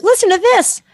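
an indignant call to listeners; outraged. Shown as a demonstration. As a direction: get behind it, fired up and indignant. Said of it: "I wanted to be outraged".

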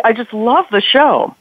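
ordinary talk

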